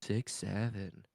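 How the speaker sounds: slow, soft drawn-out six seven; muttered meme. Retold or imitated. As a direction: mumble it slowly under the breath. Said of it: "can you say six seven".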